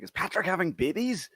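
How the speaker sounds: high, loud alarmed question; shocked disbelief. Retold or imitated. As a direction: gasp it out in horror. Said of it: "I was like".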